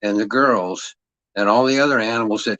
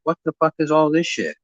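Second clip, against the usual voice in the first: higher, baffled outburst; incredulous past self; 5 semitones higher than usual. Retold or imitated. Recalling his reaction to his ex-wife's tongues, baffled. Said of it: "I was like".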